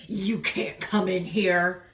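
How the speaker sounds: lightly voiced gruff guard dog; slow, a touch of menace at the door; tough bulldog. Bulldog bouncers turning the boy away, lightly gruff. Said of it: "two mean-looking bulldogs appeared and said".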